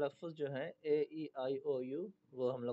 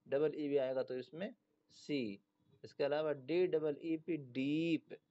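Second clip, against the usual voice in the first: ordinary delivery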